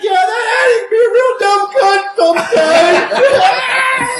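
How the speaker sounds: slow, high, loud line broken by laughter; laughing mock shout. Retelling the bikers' verdict on Hattie, loud and laughing. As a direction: crack up while voicing the biker.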